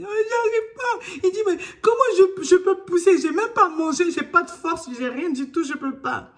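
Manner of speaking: high, loud crying complaint; tearful pleading. Retold or imitated. Mother in labor to midwife, crying she can't push. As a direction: wail that you have no strength left.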